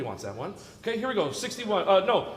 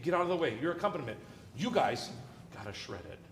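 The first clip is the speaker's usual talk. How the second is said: ordinary talk, nothing marked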